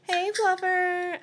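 high and slow, like calling a pet; high voice you use to talk to animals. A narrator's reading. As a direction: coax the cat in a high sing-song. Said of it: "in a high talking to animals voice".

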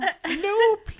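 high little voice, laughing through; childlike plea, giggling; tiny childlike voice. Acted. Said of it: "Her voice sounded tiny and childlike".

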